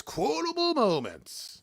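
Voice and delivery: drawn-out announcer flourish; arch segment title. Pretend. Host introduces the quotes segment, arch announcer flourish. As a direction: put on an arch accent for the title. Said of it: "the accent becomes more arch".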